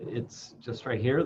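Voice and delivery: usual speaking voice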